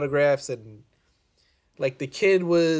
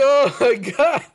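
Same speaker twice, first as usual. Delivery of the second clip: laughing disbelief; 7 semitones higher, 5 dB louder than usual. Real, not acted. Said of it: "I was like".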